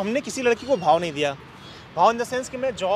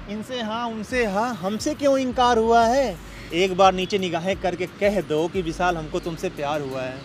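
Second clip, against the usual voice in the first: lower, dreamy poem delivery; shayari recital; 4 semitones lower than usual. Acted. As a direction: woo her with a couplet. Recites song lyric as verse.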